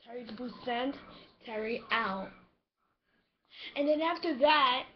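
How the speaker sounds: ordinary talk, nothing marked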